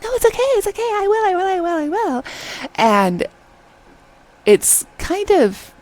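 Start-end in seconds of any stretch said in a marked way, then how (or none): 0.0-2.2 very high, squealing mock insistence; high-pitched babbling imitation of a clingy girl; annoying girl imitation. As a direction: pester in an exaggerated high voice. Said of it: "as annoying as I'm making her out to be".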